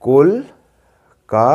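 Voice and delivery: Kolkata very slowly as কল, কা, তা; three long beats in Kolkata, pausing between them; breath between syllables. Shown as a demonstration. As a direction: stretch and separate each syllable of Kolkata. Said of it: "দম নিয়ে নিয়ে যদি বলি".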